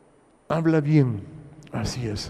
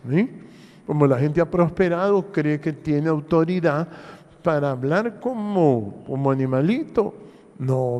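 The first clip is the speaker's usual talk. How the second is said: ordinary talk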